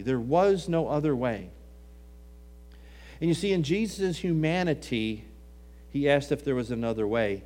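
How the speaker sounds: ordinary talk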